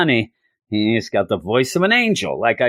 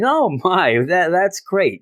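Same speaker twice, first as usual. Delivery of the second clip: higher, delighted burst; excited Johnny; 7 semitones higher than usual. Retold or imitated. Johnny reacting to Ben's engagement news, excited and higher. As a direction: gush with surprised joy.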